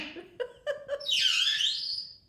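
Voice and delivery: high-pitched laughing burst. Real, not acted.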